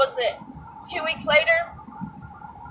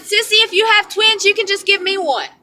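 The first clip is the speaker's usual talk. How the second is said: playful sister; 8 semitones higher than usual. Retold or imitated. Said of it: "She said".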